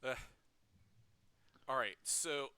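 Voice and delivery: ordinary talk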